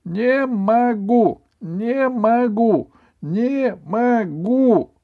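high, slow, stretched shout; defiant boy chanting slowly at full voice; loud and distinct. A narrator's reading. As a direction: hammer out every syllable, high and loud. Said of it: "проговорил громко и отчётливо".

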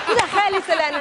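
high demand through laughter; laughing mock demand. Wife retelling her dinner outburst to the host, laughing. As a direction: laugh while repeating the accusation.

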